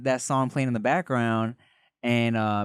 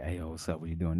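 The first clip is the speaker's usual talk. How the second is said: put-on low and soft old-school greeting; deep, low voice; 8 semitones lower, 11 dB quieter, faster than usual. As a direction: drop the voice way down. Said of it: "dudes back in the day would be like".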